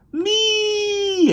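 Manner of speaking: very high, loud meow; a girl imitating the cat's yowl; mock cat meow. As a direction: squeal it like the cat. Anna's cat squeal.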